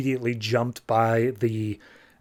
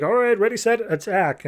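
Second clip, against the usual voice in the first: higher, raised call to attack; battle command; 7 semitones higher than usual. Retold or imitated. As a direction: fire off the order to charge. Brother Blood orders attack.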